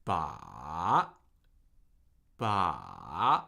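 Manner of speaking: "Ba." dipping very low, then rising at the end; low falling-rising "Ba.", slowly shown; third-tone demo. Shown as a demonstration. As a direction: draw out "Ba." through a deep trough and up. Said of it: "we're gonna do this with ba".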